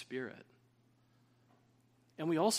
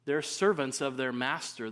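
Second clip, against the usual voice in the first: ordinary delivery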